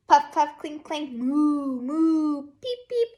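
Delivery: teacher reading Down by the Barn to her class, sing-song; reading to children. Acted. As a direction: read it like a storybook for little kids. Reads picture book aloud.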